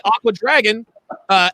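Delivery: usual speaking voice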